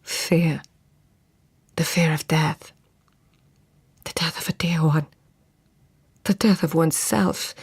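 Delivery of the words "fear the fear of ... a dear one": whispering. Acted. Fevered dream monologue, whispered.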